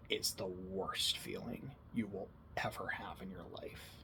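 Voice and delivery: low, slow and very soft, sinking to a whisper; slow hush fading into near-whisper; hushed, solemn father. Retold or imitated. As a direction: quote a dad's grave, heartfelt words, pausing often. Retells dad's heartfelt words at screen.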